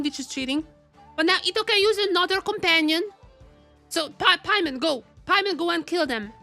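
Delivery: usual speaking voice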